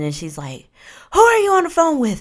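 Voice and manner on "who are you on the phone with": high, loud, fast demanding shriek; angry woman. Retold or imitated. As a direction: confront him in a high, jealous woman's voice. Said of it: "I hear this woman".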